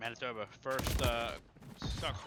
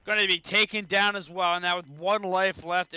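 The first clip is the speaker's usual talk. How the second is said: high, loud, punched-out commentary; excited kill call; 7 semitones higher, 8 dB louder than usual. Real, not acted. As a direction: shout each kill as it lands. Calling a multi-kill live.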